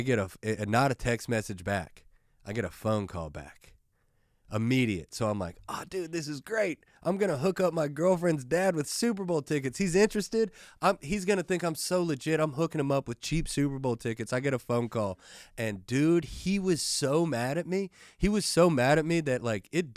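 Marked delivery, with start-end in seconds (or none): 5.7-14.3 high-pitched, giddy retelling of his own delight; naive excitement. Retold or imitated. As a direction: gush it much higher, thrilled with yourself.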